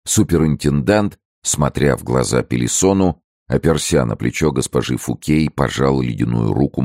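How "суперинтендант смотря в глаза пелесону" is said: plain reading voice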